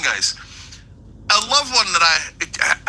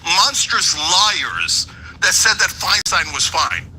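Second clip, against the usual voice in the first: usual speaking voice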